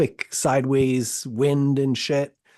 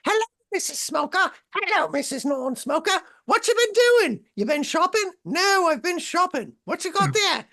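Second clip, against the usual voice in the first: put-on very high, screechy-silly English accent; mock English housewives. Couple mimicking overheard diners, mock English housewife voices. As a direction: chat as two gossiping English missuses in falsetto. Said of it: "we'd hear somebody talking and be like".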